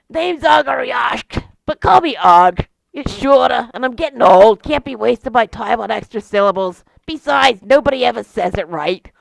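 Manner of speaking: put-on loud, chatty Boston accent; old Boston guy griping about his long name; Bostonian accent. Weary beholder introduces himself in a loud Boston accent. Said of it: "the beholder with a Bostonian accent".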